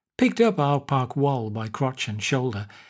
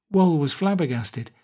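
plain reading voice

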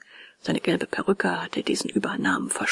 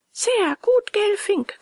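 much higher, squeaky tiny voice; fine shrieking little voice; 12 semitones higher, slower than usual. A narrator's reading. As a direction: screech it like a mischievous little voice. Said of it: "kreischte ein feines Stimmchen". (feines, kreischendes Stimmchen)

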